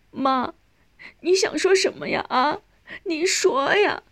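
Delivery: high, tearful pleading; crying. A narrator's reading. Daughter pleading with speechless dying mother, crying. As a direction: beg through tears for an answer. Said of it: "少英已经哭成个泪人儿".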